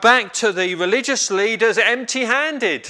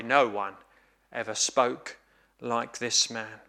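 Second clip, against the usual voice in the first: soft, awed quote; 7 semitones lower, 7 dB quieter than usual. Acted. Preacher quotes the officers again.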